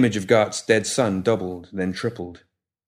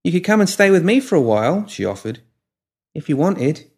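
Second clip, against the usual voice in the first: put-on cheerful young woman; 8 semitones higher than usual. A narrator's reading. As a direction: voice her brisk and eager. Molly offers to host.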